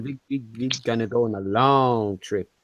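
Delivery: low seductive voice; sexy voice. Pretend. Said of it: "with my sexy voice".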